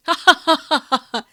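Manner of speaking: loud maniacal villain cackle; evil laugh. Pretend. Mock villain gloating laugh.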